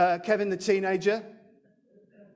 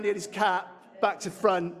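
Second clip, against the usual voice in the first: ordinary talk, nothing marked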